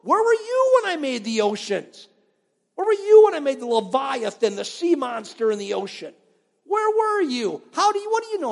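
high, loud booming taunt; God taunting Job. Retold or imitated. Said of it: "almost like taunting him".

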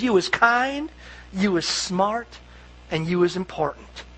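soft, gently fading reassurance; tender. Retold or imitated. Nanny holding the child's face, soft and tender. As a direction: speak it softly into a child's face. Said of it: "she holds them by the face and she says".

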